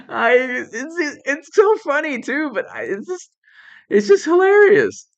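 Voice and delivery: high, breathless with laughter; laughing. Real, not acted. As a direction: laugh it out, barely able to speak. Breaking up laughing.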